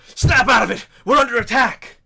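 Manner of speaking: full-force high shouted alarm; yelling. Acted. Burgomaster rousing party as village is attacked, yelling. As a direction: shout to rouse dazed allies. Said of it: "yells to you, says".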